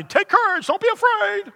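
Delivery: a feeble squeak with no power behind it; weak mock version. Shown as a demonstration. Preacher mocking his own empty comfort, squeaky high. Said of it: "I could tell you".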